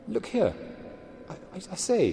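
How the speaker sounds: high, halting stammer. A narrator's reading. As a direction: stammer it out nervously. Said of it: "he stammered".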